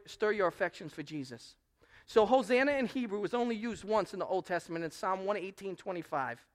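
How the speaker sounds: usual speaking voice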